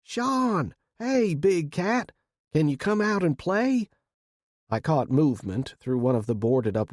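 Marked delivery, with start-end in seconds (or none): 0.0-3.9 high-pitched playful holler; calling out. A narrator's reading. Will calling his friend out to play from the truck, calling out. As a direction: call up to the house in a raised, stretched voice. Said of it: "calling out".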